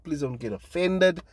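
ordinary delivery

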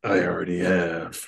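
a hint of a gruff American tough guy; lightly voiced gravelly American line; understated gravelly American reply. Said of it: "That was my gravelly American voice".

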